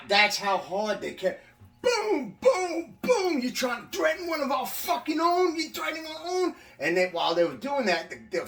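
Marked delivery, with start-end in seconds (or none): 1.8-3.4 much higher, sharp mock impact sounds; punch sounds. Teller mimics guards' punches on his ribs, imitated thuds. 3.4-6.5 much higher, imitating a furious guard; guards yelling. Guard screams at inmate while punching him, imitated angry yell.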